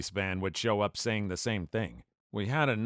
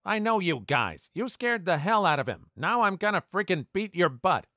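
higher, louder mock threat; threatening bully; 9 semitones higher, 4 dB louder than usual. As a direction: menace the little kid.

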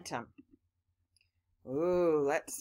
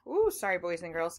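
ordinary talk, nothing marked

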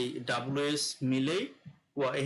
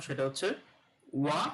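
usual speaking voice

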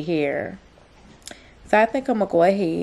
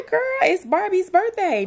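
high, thrilled and giddy; excited birthday squeal; 12 semitones higher than usual. Real, not acted. Mom gushing to her toddler about her birthday, high and excited.

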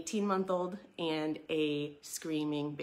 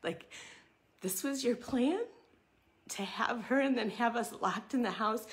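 exasperated question; 6 semitones higher than usual. Retold or imitated. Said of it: "sitting in that bedroom going".

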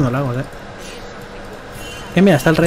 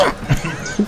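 exasperated laugh; 3 semitones higher, 5 dB quieter than usual. Real, not acted. Laughs after outburst.

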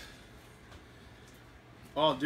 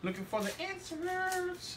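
much higher, slow, drawn-out inserts; silly high voice; 14 semitones higher, 7 dB quieter, slower than usual. Pretend. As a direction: stretch it out in a goofy high voice.

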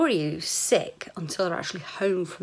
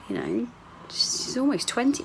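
usual speaking voice

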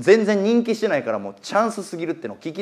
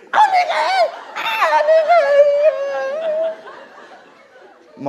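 much higher, strained pleading cry; pleading old man; much slower than usual. Retold or imitated. Old man on the right begging his horse to win, high pleading cry. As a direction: beg the horse like a desperate old man, breaking into a laugh. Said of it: "って言い出したんですよ".

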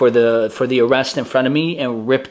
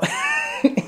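high squealing laugh; 4 dB quieter than usual. Real, not acted. Laughs after the shouted answer.

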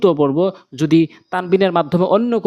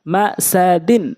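drawn-out syllable by syllable reading; slow demo; much slower than usual. Shown as a demonstration. Teacher showing learners the word first, slowly. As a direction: stretch each syllable for learners. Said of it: "প্রথমে আমি slow বলছি".

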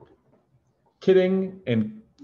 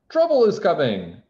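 loud, high ominous warning; ominous bird; 7 semitones higher, 6 dB louder than usual. A narrator's reading. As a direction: warn of doom. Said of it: "he said".

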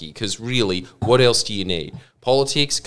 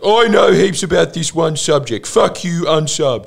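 smug know-it-all; 7 semitones higher, 5 dB louder than usual. Retold or imitated. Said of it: "It's just like".

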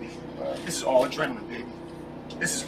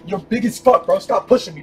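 usual speaking voice

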